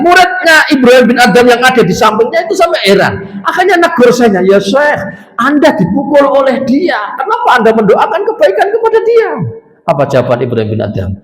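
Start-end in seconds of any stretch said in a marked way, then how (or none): 0.0-9.3 can't believe what he just saw; puzzled student. Retold or imitated. Student questions his teacher.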